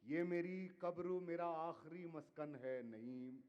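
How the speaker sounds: measured, declaiming poetry to audience; ghazal recitation. Acted. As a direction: recite each couplet with weight. Poet recites final couplet.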